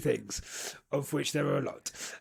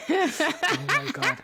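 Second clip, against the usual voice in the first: high squealing laugh on the words; 11 semitones higher, slower than usual. Real, not acted. Host cracking up at his own impression. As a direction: gasp it out while cracking up.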